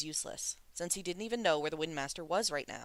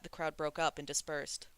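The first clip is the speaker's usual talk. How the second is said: ordinary delivery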